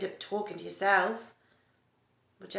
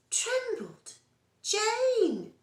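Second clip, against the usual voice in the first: much higher, mock-outraged cry; indignant surprise; 14 semitones higher, 3 dB louder, much slower than usual. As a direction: toss back her word in shocked protest. Indignant retort to friend.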